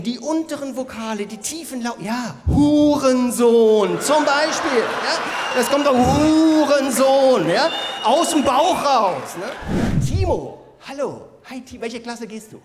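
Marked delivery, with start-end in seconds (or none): 2.0-9.4 slow, loud insult from the gut; from the belly. Shown as a demonstration. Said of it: "immer die tiefen Vokale nehmen".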